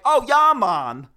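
loud, high, slow Jamaican caricature; fake Jamaican accent. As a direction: belt out a mock Jamaican accent. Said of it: "Give me Jamaican".

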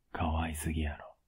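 tender whisper. Acted. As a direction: whisper it lovingly. Touched by girlfriend's confession.

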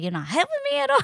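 very high squeaky protest; comically exasperated squeal, ready to laugh; mock complaint. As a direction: whine it way up high, half joking. Acted exasperated retort to nervous husband.

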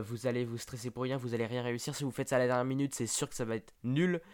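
ordinary talk, nothing marked

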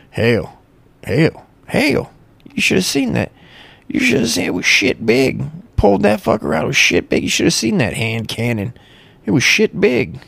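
high-pitched hillbilly brag with stretched vowels. Pretend. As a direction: crow like a backwoods yokel. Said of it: "If you say shit big".

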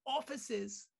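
very high English accent; Russell Brand. Shown as a demonstration. Host raising the impression on request, high English accent. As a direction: pitch it right up as a cheeky English comic. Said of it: "make it a little bit higher".

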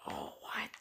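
whispered surprise. Retold or imitated. Imitating their surprised reaction.

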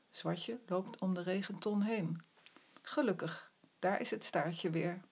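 plain reading voice

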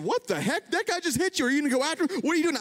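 a friend shouting over a sideswipe, alarmed and high; excited friend. Retold or imitated. Friend in passenger seat yells after the hit, alarmed. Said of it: "Brian said".

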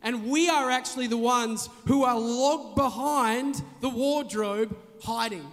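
normal talking voice

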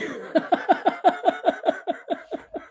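high, breathy giggle that trails on; laughing fit. Real, not acted. Host laughs at comment about her sarcasm, helplessly. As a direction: crack up mid-reading.

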